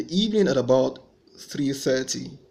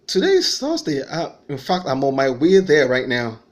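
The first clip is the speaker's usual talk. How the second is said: indignant surprise; 8 dB louder than usual. Retold or imitated. Insisting to his confused friends.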